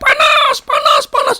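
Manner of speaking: very high mock scream; retold screaming. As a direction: act out her crying out in a thin high voice. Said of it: "dia teriak-teriak".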